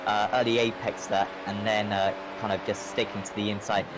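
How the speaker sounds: ordinary delivery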